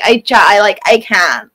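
whiny mock Valley Girl accent. Shown as a demonstration. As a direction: imitate a whining Valley Girl. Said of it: "it's very whiny".

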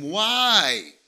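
a long, dismayed why, acted out; dismayed question. Customer asks hairdresser about the cut hair, dismayed.

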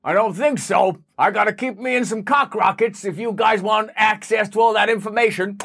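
Jeff Bezos. Acted. Billionaire refusing the tax collector, cocky caricature voice. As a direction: brush off the demand as a cocky billionaire. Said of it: "Jeff, we're gonna have to tax you".

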